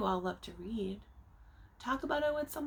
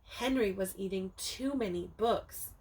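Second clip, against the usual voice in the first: usual speaking voice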